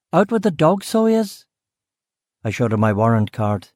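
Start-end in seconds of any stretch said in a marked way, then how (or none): 0.1-1.4 higher Belfast woman's voice, curt; brusque West Belfast cook. Acted. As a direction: Belfast woman, short with the visitor. Voiced cook Aileen answering.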